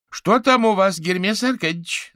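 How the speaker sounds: high, loud call across rooms; calling from kitchen. Acted. Someone in the kitchen asks Averin what is happening, calling out. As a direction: call out a question from another room. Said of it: "донеслось с кухни".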